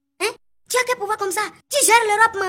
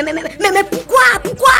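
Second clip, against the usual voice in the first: mock outrage; 4 semitones higher, 5 dB louder than usual. Outraged reaction to Lolo.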